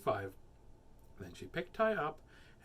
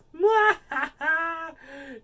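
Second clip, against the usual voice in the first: high, loud mock cackle; hammed-up laugh; 14 semitones higher, 12 dB louder than usual. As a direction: cackle loudly at your own joke. Silly interlude after joke.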